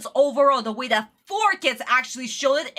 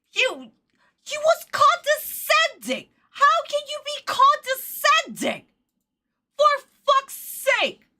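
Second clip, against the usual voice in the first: high-pitched, emphatic indignation; outraged rant; 10 semitones higher, slower than usual. Real, not acted. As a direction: hammer out the complaint high and incredulous. Ranting about the show.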